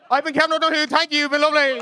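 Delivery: high, loud farewell over applause; rousing sign-off. Real, not acted. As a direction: thank the crowd at full voice. Comedian signs off to applause.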